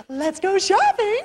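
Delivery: high, slow chirpy mom imitation; sing-song like an eager mom dragging her kid along; mock excited mom. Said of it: "Moms are like".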